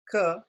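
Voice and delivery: "Ka" crisp with no puff; unaspirated ka. Shown as a demonstration. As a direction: hold back the breath. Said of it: "There's a difference between".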